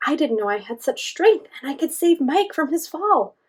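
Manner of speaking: amazed at her own strength; Sasha's voice. A narrator's reading. Sasha speaks in story.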